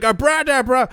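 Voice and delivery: high-pitched, playful put-on yell; mock screaming. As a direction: mimic yourself screaming gibberish. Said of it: "I feel like I'm screaming".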